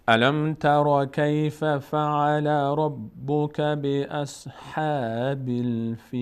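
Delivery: slow melodic tajweed chant; Quran recitation. Shown as a demonstration. Teacher reciting the verse for the class. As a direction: recite slowly, drawing out the vowels.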